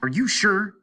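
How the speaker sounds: loud, high urgent question; frantic shout. A narrator's reading. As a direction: shout it, shaking him. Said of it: "shouted Hart".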